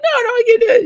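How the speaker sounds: very high, fretful falsetto; mock panicked woman. Mother at window to football player, high mock panic. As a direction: protest in a put-on high voice. Joke mother answers hero.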